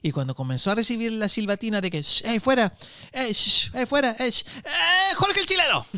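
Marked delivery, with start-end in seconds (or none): 2.0-5.8 boo him off like a rowdy crowd, rising; mock crowd jeering. Comic imitating four thousand people booing the host.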